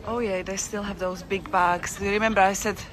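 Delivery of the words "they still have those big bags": usual speaking voice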